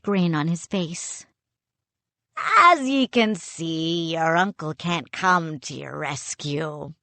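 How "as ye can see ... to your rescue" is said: put-on a pirate captain gloating to captured boys. A narrator's reading. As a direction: menace them with a pirate's sneer.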